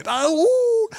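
very high, drawn-out imitated holler; screaming for someone. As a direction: imitate a far-carrying yell in a thick Guatemalan accent. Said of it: "and he's screaming".

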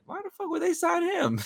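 high-pitched retold disbelief; squeaky comic incredulity; incredulous. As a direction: let the voice shoot up in baffled protest. Retells own incredulous reaction, then laughs.

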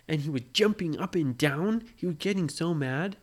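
usual speaking voice

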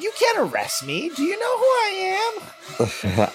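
very high, stretched-out mock protest; indignant Karen. Karen protesting arrest, high mock-indignant whine. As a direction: squeal it with hammed-up entitlement.

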